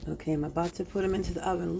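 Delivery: ordinary delivery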